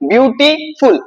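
বিউটিফুল split in two beats, বিউটি, then ফুল; syllable demo. Shown as a demonstration. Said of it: "দুইবারে উচ্চারণ হচ্ছে".

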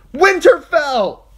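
loud, slow high scream. A narrator's reading. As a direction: scream the battle cry. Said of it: "she screamed".

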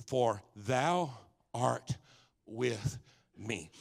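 slow, word by word with pauses; deliberate scripture quote. Real, not acted. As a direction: let each word of the verse sink in, softening at the end. Preacher spells out key verse.

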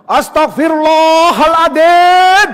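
long, high bellowed prayer; hammed-up furious exclamation; angry istighfar. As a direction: roar the prayer at a child, drawn out. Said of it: "Loh, astagfirullah kok marah?".

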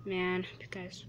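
hushed unvoiced aside; whispered to the viewers. Real, not acted. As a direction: breathe it under your breath. Whispered aside mid-commentary.

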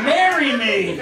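ordinary delivery

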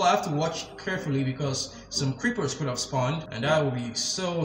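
ordinary talk

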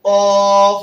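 কফ said very loud; dictated letter. Shown as a demonstration. Teacher dictating a letter out of order: loud কফ. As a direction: bark out কফ clearly for the class. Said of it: "আমি উচ্চারণ করলাম".

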